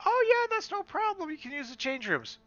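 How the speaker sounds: very high, sing-song mock of a receptionist; high mocking staff voice; chirpy staff voice. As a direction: play the breezy spa attendant. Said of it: "staff reply in retold dialogue".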